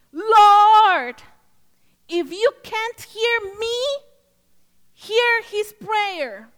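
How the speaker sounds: loud, high cry to the Lord, retold; screamed prayer. Woman praying in her pulled-over car, screaming to God. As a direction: re-enact a desperate screamed plea, stretching the words.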